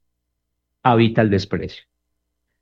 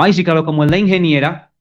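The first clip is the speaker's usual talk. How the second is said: higher, sneering mock praise; mocking joke about the wife's abilities; 7 semitones higher than usual. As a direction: ridicule her skills. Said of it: "Hacer un chiste respecto a su capacidad".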